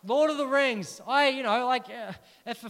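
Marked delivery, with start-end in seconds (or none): 0.0-1.0 emphatic repeat. Real, not acted.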